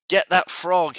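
a king's outburst, mildly played; furious cry. A narrator's reading. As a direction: cry out the order, pitch jumping up. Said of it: "The king was furious".

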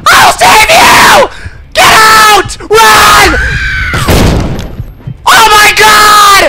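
high, loud frantic shouts; panicking gamer. Real, not acted. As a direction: yell in desperate panic. Chase, rushing to rescue.

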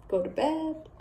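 plain reading voice

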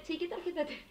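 cheeky date question. Pretend. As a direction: ask it sweetly like a gold digger. Imitating asking a Tinder date. (a una cita de Tinder, pregunta pícara y aguda)

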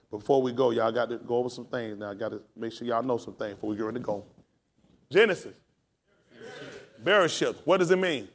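ordinary talk, nothing marked

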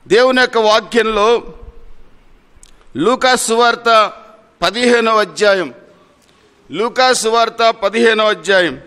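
loud, rolling pulpit delivery; full-force preaching. Acted. Pastor urging the congregation toward obedience, preaching loudly. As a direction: preach it out to the whole church, slowing to hammer key lines.

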